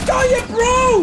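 high, loud gloat; triumphant shout. Real, not acted. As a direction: crow over the kill. Triumph after a kill.

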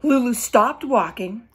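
ordinary delivery

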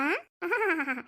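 long ha-ha-ha laugh; delighted, drawn-out cackle; playful laugh. Acted. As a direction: laugh out to amuse a baby. Grandmother plays with baby.

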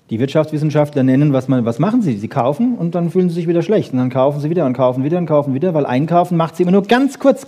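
normal talking voice

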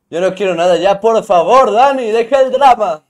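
más agudo y fuerte, suplicando que pare; protesta exaltada. Real, not acted. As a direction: exagera la queja para Dani. Protesta dramática ante Dani. (súplica exaltada y aguda)

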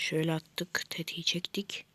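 soft unvoiced whisper; quiet sneaky whisper. Real, not acted. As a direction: hush it out as you fire. Whispering while shooting.

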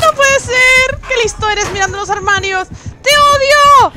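high, very loud wail of protest; outraged screaming. Real, not acted. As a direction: scream it at the killer, stretching the words. Protesta a gritos al asesino. (gritos agudos de rabia e impotencia)

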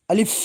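আলিফ said short and crisp, with no stretch on the vowel; short alif. Shown as a demonstration. Teacher showing students alif without any stretch. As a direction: say আলিফ clipped, no lengthening at all. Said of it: "এই হরফটির মধ্যে কোনো টান হবে না".

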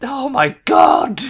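slow, high, loud cry of despair; furious shout. Real, not acted. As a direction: let it out like you've snapped. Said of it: "The rage!".

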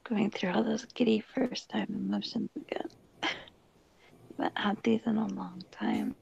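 hushed whisper so nobody wakes; whispering late at night; quiet at night. Real, not acted. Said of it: "I'm talking quiet because my roommates are asleep".

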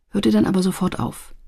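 ordinary delivery

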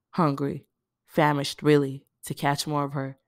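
ordinary delivery

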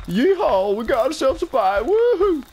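high, excited mock hillbilly accent; hillbilly whoop. Acting hillbilly killer chasing victims.

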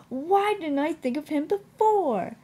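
high, loud excited exclamation; sudden realisation. A narrator's reading. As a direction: burst out with it as the idea strikes. Edward's sudden happy idea.

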